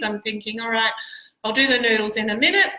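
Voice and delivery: ordinary talk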